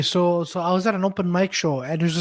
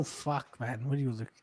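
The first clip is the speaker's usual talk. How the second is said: muttered aside; 8 semitones lower, 17 dB quieter than usual. Retold or imitated. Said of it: "I'm like".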